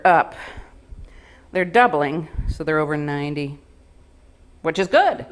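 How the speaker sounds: a lightly voiced nurse, low and even, giving nothing away; flat ambiguous voice. Nurse Donna phoning with test results, low and unreadable. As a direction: deliver the news so no one can tell if it's good or bad. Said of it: "you can't tell if it's good news or bad".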